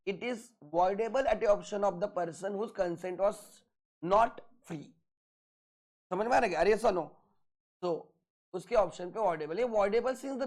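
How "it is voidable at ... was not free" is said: very slow, word by word dictation; dictating notes. Shown as a demonstration. Teacher to class, dictating notes very slowly. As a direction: spell it out slowly so they can write.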